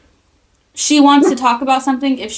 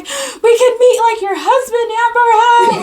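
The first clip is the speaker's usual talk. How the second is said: excited fangirl squeal; 10 semitones higher than usual. Retold or imitated. Fangirling over concert news.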